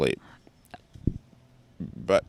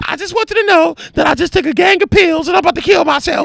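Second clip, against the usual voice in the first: loud, very high mock wail; hammed-up hysterical phone call; mimicked hysterical friend; 6 dB louder than usual. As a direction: cry it out in falsetto, imitating her. Acts friend's dramatic phone threat.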